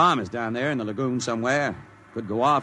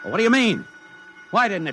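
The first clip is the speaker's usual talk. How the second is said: angry demand; 9 semitones higher, 4 dB louder than usual. Acted. General demands why bomb failed.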